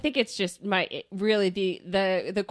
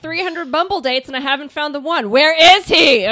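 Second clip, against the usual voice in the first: exasperated; 5 semitones higher, 7 dB louder than usual. Retold or imitated. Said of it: "You're like".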